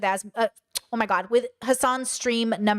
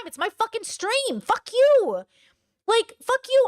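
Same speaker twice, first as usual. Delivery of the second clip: mock streamer rant; 9 semitones higher, slower than usual. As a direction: rant at the chat like an angry streamer.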